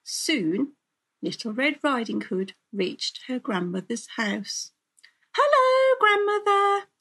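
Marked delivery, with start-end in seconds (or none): 5.3-6.9 very high, loud singsong greeting; high-pitched child's halloo; calling out. A narrator's reading. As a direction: call brightly through the house as a little girl. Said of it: "she called".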